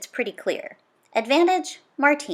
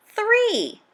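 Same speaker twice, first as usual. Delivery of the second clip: much higher, excited burst on Three!; excited exclamation; 11 semitones higher than usual. A narrator's reading. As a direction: exclaim it, amazed at the feat.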